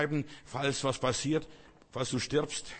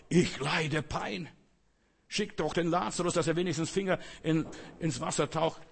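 tormented rich man; 5 semitones higher than usual. Acted.

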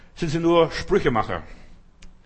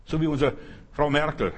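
usual speaking voice

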